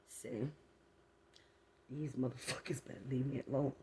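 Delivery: ordinary talk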